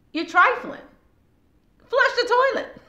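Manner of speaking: high, laughing mock scold; exasperated scolding. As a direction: scold them while cracking up. Acted quarrel over the toilet.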